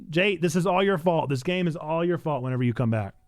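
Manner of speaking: ordinary talk, nothing marked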